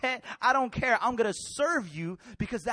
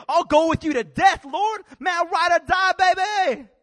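loud, eager boast, pitch way up; Peter bragging; 6 semitones higher, 9 dB louder than usual. Acted. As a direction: shout your loyalty like a hyped-up buddy. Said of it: "Peter said".

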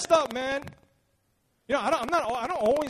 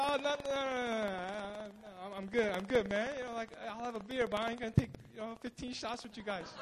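sheepish refusal; 9 dB quieter than usual. Retold or imitated. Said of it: "And I was like".

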